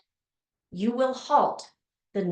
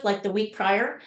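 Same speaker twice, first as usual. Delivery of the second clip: ordinary talk, nothing marked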